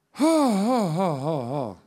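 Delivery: high, loud "ha" stepping down in pitch; loud descending ha-ha. Real, not acted. Preacher about to address the congregation, loud falling ha-ha. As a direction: let out a string of ha's, starting high and falling away. Sighing off nerves before preaching.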